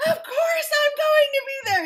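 hammed-up thrilled squeal; mock giddy excitement. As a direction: gush like you always knew it. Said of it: "then you're like".

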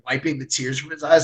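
ordinary talk

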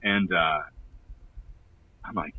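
normal talking voice

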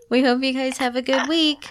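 high, loud outro voice; peppy sign-off. Pretend. Host signing off to listeners, peppy and loud. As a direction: send listeners off loudly and high.

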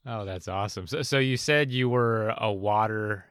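ordinary delivery

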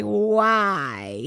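long drawn-out, falling wail; retold crying. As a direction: stretch it into an anguished mock wail. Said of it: "And I'm just crying".

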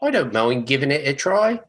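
put-on Oliver Twist-style British waif accent; earnest but off-target British accent; orphan British accent. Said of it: "That's a little bit more orphan".

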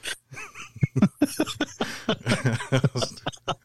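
breaking into breathy laughter. Real, not acted. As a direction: giggle that breaks the act. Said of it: "I can't do my spooky voice".

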